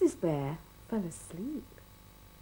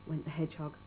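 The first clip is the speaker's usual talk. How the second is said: plain reading voice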